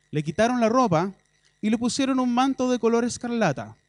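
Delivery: loud, building preaching read; forceful scripture reading. Acted. Preacher reading the mockery of Jesus, loud and forceful. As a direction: proclaim the passage with rising force.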